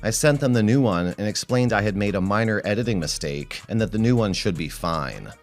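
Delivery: plain reading voice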